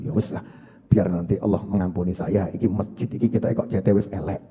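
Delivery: low, hushed musing to oneself; remorseful self-talk. Acted. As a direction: murmur it softly, as if thinking aloud. Acts remorseful man musing to himself. (gumaman menyesal, pelan dan rendah)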